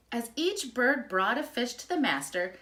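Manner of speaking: ordinary delivery, nothing marked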